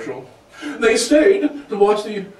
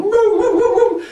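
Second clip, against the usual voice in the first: high-pitched imitated dog woofing; dog howl; 12 semitones higher, 4 dB louder than usual. Family dog joining the shouting at the TV, howling. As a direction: hoot loudly like an excited dog. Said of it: "you have the dog going".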